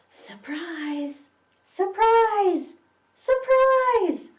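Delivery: from whisper to excited shout; building surprise. Acted. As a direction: whisper rising to a high loud cry.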